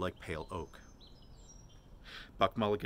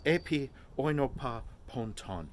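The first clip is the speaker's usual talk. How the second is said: Greek recitation; 5 semitones higher than usual. A narrator's reading.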